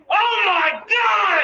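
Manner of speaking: high startled yelp of surprise; a sudden, very high mock scream; shocked shout. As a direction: yelp like you've been ambushed. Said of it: "And so I shouted".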